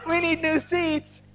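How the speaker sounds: high, drawn-out comic scream for help; hammed-up high-pitched plea to the stewardess; mock scream. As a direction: shout in exaggerated panic, easing in volume but staying high. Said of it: "I scream".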